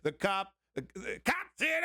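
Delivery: sudden high shout. Real, not acted. Outburst naming Cop City.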